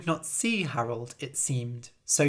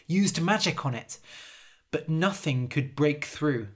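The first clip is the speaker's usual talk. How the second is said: plain reading voice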